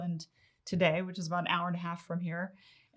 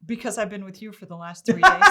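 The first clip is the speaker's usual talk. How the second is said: laughing reply; 2 semitones higher, 10 dB louder than usual. Real, not acted. Teasing answer to husband.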